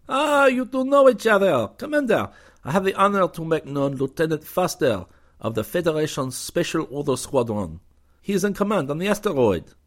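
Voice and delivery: gracious Frenchman welcoming a lieutenant; cordial French commander. A narrator's reading. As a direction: greet the young hero with courtly charm.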